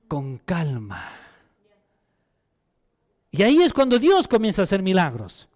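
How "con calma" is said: slow, soft and low, settling the voice; calm it right down, slowly. Shown as a demonstration. Pastor showing the congregation how calm sounds, slow and soft.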